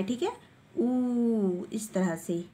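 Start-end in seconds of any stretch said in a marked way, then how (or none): 0.7-1.6 ऊ held long; long vowel. Shown as a demonstration. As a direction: stretch out the ऊ. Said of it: "इसको लंबा खींच के बोलना है".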